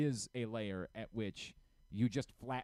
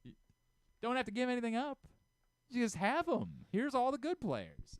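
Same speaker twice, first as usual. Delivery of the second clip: mock generous giveaway; 9 semitones higher, 4 dB louder than usual. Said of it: "they're just like".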